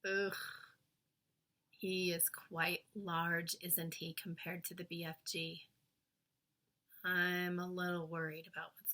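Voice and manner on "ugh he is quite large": ordinary delivery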